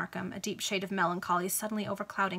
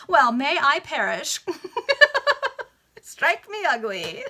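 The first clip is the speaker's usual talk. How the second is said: slow, high, loud foppish oath; laughing dandy; 8 semitones higher, 8 dB louder, slower than usual. Acted. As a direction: ham up the dandy with giggles.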